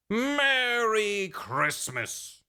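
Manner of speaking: very slow, mock-sentimental; drawn-out sappy sign-off. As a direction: stretch it like a cheesy movie ending. Sappy ending joke.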